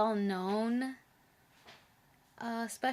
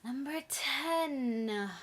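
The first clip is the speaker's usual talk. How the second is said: slow, drawn-out reveal; dramatic announcement; 5 semitones higher, much slower than usual. Pretend. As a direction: stretch it like a big reveal. Repeats her dramatic announcement.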